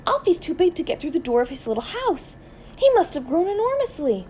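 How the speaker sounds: high-pitched, alarmed cry to the neighbor; startled shouting. A narrator's reading. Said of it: "Missus Silver was shouting".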